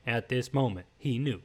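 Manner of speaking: low, quiet documentary-style narration; hushed mock narrator line; meme narrator voice. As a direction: intone it like the meme caption. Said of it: "the meme where it's like".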